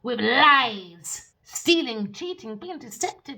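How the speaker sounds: preacher-style loud rebuke; loud denouncing. Acted. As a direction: call out the liars loudly. Denouncing liars.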